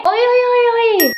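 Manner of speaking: high, loud hey-hey calls; loud calling out. Acted. Woman loudly calling over to her sister-in-law. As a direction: shout across to get her attention.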